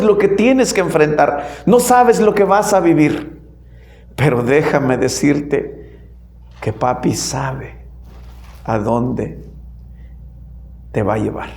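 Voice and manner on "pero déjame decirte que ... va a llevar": tender reassurance. Real, not acted. Tender assurance to congregation.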